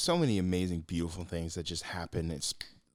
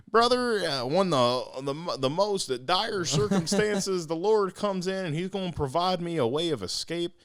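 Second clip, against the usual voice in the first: much higher, louder, slow Obama-style preaching; Obama impression; 11 semitones higher, 7 dB louder than usual. Man on the roof tells the helicopter no, as Obama. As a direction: stretch it out like Obama at a podium. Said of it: "a little Barack Obama impression in there".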